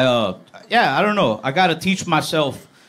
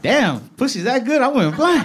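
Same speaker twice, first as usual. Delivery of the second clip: mock amazed; 10 semitones higher than usual. Acted quote of himself in awe.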